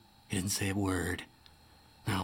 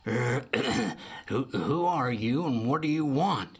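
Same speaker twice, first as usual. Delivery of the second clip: put-on 1 grumbling old bear. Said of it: "he grumbled".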